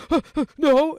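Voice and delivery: high, loud mock-panicked stammer; flustered denial. Teenager denying an insinuation, high mock-flustered squeal. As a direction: imitate a rattled teen squeaking a denial.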